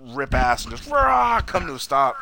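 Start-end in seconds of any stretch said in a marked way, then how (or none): 0.9-1.4 high mock engine roar; imitated car roar. Fan mimics the DeLorean ripping past, imitated car roar.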